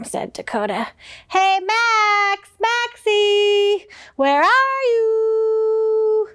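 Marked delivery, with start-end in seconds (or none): 1.3-6.3 calling out. Acted. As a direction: call out to find Max. Brody calling through the house.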